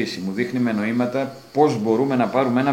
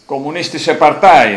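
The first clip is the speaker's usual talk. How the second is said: loud, higher, carefully correct German Kommunistische Partei; proud German; 6 semitones higher, 11 dB louder than usual. Retold or imitated. Giant proudly naming his party in German, loud. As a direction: say the German just right, head high. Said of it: "το προφέρει γερμανικά σωστά, με περηφάνεια".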